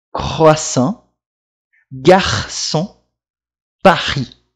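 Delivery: "Croissant, garçon, Paris" with a throaty French R. Shown as a demonstration. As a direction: say "Croissant, garçon, Paris" one at a time, R scraped at the back. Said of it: "Esse som do R é bem típico em francês".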